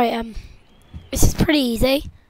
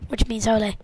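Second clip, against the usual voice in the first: ordinary delivery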